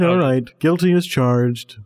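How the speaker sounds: ordinary delivery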